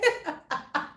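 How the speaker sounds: bursts of high laughter; real giggling fit; high giggles. Real, not acted. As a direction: keep breaking into laughter. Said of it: "I'm laughing too much".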